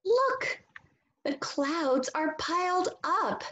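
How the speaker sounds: high, excited exclamation; lots of feeling. Shown as a demonstration. Teacher showing the class how to read an exclamation, excited and high. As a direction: call it out with wonder. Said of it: "read it with a lot of feeling".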